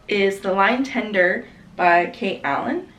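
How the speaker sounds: usual speaking voice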